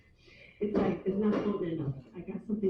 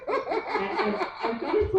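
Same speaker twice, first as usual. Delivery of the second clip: laughing through the words; slower than usual. Real, not acted. Comic tells the crowd, laughing. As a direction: breaks up laughing mid-sentence. Laughs at her own confession.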